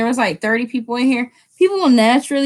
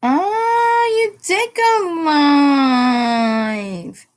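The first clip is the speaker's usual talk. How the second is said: high wail of delight, stretched long; excited squeal; 6 semitones higher, much slower than usual. Real, not acted. Streamer seeing a friend went live, excited squeal. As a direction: draw it out in a high cry, sliding down.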